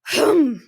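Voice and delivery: Mama Bear unimpressed by the hungry pair; loud skeptical hmm. Acted. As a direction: grunt your disbelief.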